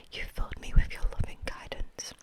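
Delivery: ordinary delivery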